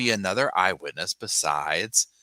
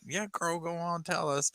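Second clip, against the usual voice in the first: high, softer teasing dare; sassy dare; 9 semitones higher, 7 dB quieter than usual. Retold or imitated. Host daring the suspect to explain, high teasing. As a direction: egg her on knowingly. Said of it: "Where I'm like".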